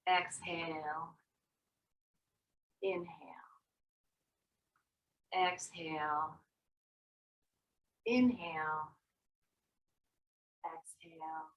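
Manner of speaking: soft, low breathing cues; guided breathing. Acted. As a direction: fade each cue gently.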